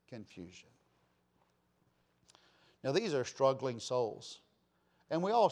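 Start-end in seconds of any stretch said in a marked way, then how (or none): 0.1-0.7 Confusion. repeated much softer and lower; hushed echo. Shown as a demonstration. Preacher echoing the word, hushed and low. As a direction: drop Confusion. to a near hush.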